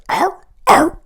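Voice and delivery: high loud wolf howl. Pretend. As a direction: howl it out like a dog.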